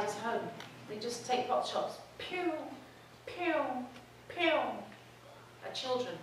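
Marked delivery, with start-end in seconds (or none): 2.2-4.6 high, soft "pew" shot noises; imitated gunshots. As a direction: mimic distant pot shots quietly. Imitating sniper pot shots.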